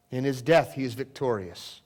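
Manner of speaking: normal talking voice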